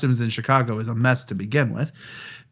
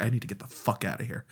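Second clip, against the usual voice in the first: fast, much softer mutter under the breath; smile dropped; 4 semitones lower, 12 dB quieter, faster than usual. Retold or imitated. Walking away from the customs agent, quick soft mutter. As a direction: drop the act and mutter it to yourself. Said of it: "my smile dropped and I was like".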